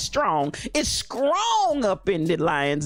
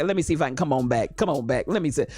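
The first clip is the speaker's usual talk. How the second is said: ordinary talk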